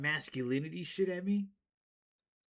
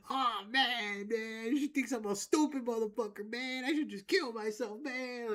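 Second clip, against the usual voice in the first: mock self-pitying guy, pitched higher, each "man" trailing off; sulking dude; 6 semitones higher than usual. Mocking dudes who sulk after a girl laughs. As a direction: whine it like a wounded ego. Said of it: "Most dudes would be like".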